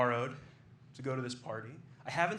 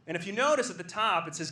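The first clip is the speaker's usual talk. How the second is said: ordinary delivery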